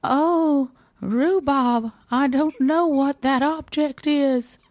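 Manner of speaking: high-pitched exclaiming heroine; Miranda. A narrator's reading.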